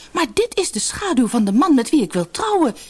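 high-pitched, astonished exclamation; exclaiming. A narrator's reading. Sina finds the shadow of her chosen man, exclaiming. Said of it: "riep Sina uit".

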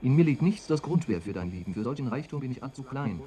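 measured, solemn verse reading; lofty poetry reading with rising and falling lines; poem recital. Acted. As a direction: recite it like a sonnet on stage. Solemn sonnet recital read to the cloud.

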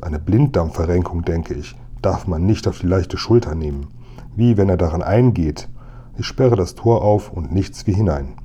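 usual speaking voice

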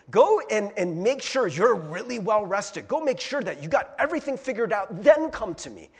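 loud, insistent list of conditions; hammed-up pushy demand; mock demanding command. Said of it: "He doesn't say".

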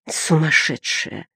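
slow, hushed dawning fear; shuddering realisation. A narrator's reading. Boy realising the prisoner is mad, quietly shuddering. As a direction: say it slowly, with an understated shudder. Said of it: "с содроганием догадался малыш".

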